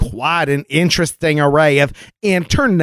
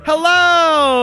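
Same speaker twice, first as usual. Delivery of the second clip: hammed-up hello; 12 semitones higher than usual. Show greeting in put-on voice.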